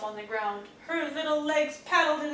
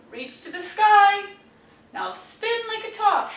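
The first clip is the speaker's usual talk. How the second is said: higher, louder, leading the dance; excited instructions; 7 semitones higher, 7 dB louder than usual. Acted. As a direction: call out the moves like a cheerful leader. Olivia leads the rain dance.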